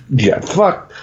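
usual speaking voice

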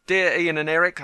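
ordinary talk

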